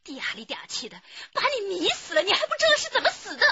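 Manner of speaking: high, loud jealous tirade, rushing at the end; wife yelling about the other woman, high, loud, fast; furious shout. Acted. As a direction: hurl the insult at him. Wife quarrels with husband.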